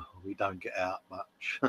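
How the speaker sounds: quietly quoting the wife; soft, low mimic of the wife; soft quoted wife. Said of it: "my missus was like".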